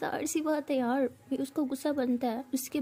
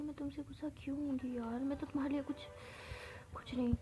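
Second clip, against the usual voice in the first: soft mumble; 3 semitones higher, 12 dB quieter than usual. A narrator's reading. Meera answers softly.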